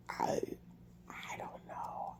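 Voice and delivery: breathy whisper, nearly voiceless. Real, not acted. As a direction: whisper it, worn out and upset. Upset, whispering to camera.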